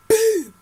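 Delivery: punch sound effect. Retold or imitated. Acting out a punch.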